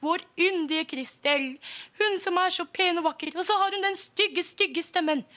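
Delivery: mildly mournful sighing complaint; disappointed parents. Acted. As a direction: parents lamenting their daughter's ugly voice, mildly sad. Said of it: "litt skuffet og lei seg".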